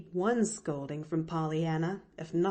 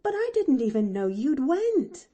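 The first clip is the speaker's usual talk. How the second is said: raised, louder protest; crying out; 8 semitones higher, 6 dB louder than usual. A narrator's reading. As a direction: exclaim it higher, in alarmed protest. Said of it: "cried Nancy".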